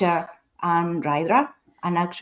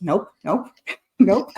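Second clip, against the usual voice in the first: laughing nope. Retold or imitated. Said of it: "my engineering brain was like".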